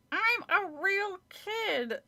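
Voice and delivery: high, slow, through gritted teeth; ventriloquist's high dummy voice; squeaky dummy voice. Acted. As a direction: speak for the puppet without moving your lips. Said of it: "high-pitched voice through gritted teeth".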